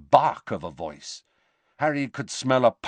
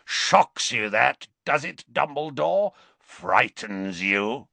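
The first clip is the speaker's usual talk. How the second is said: taunting Greyback; 3 semitones higher than usual. A narrator's reading.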